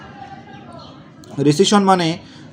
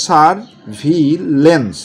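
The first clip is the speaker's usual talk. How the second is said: Surveillance stretched out very slowly; slow spelling-drill surveillance; slow syllable by syllable; much slower than usual. Shown as a demonstration. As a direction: read surveillance slowly, each syllable clear. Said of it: "এরকম করে আপনি পড়বেন।".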